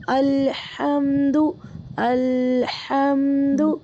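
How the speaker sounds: الحمدو with a clear glottal hamza on ال, drawn out slowly; slow Arabic hamza. Shown as a demonstration. Tutor demonstrating الحمدو slowly for her student. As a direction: say الحمدو slowly, stopping the breath before ال. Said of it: "تو ال میں حمزہ والی آواز آنی چاہیے".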